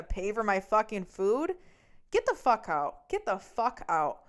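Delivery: loud, forceful retold outburst; angry command. Girlfriend kicking boyfriend out of her car, angry command. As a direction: order them out, hitting each word.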